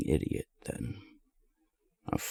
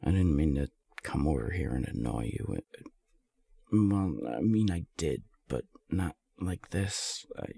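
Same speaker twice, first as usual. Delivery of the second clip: ordinary talk, nothing marked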